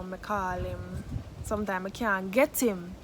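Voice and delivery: ordinary talk, nothing marked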